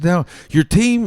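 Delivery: high, loud, urgent; coach announcement. Retold or imitated. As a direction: grab their attention quickly. Coach speech.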